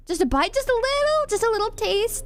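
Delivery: coaxing hungry voice. Pretend. Pretending to nibble a sheep.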